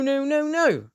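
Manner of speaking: normal talking voice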